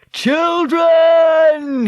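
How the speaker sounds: long, high, loud scream; screamed call. A narrator's reading. Man left behind in the river calls the girls, screaming. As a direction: scream it after fleeing children, drawn out. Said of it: "he screamed after them".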